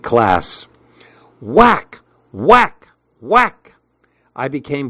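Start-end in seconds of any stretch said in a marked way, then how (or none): 1.4-3.7 punchy whacks. A narrator's reading. Acting out fly swatter hits.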